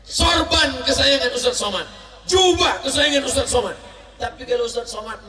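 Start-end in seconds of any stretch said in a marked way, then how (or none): 0.0-3.8 loud preaching proclamation. Real, not acted. Preaching at full force.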